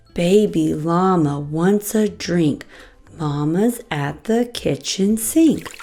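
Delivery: ordinary delivery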